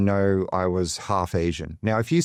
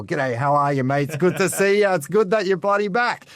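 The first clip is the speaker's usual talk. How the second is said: much higher, hammed-up broad Australian accent; broad Aussie father; 9 semitones higher than usual. Son imitating his Australian father's greeting, broad Aussie accent. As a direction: imitate a dad's Aussie greeting, high and cheery. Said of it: "that's how he talks".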